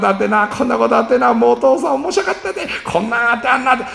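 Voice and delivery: excited dad. Pretend. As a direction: bubble over with the day's news. Vater erzählt begeistert vom Tag.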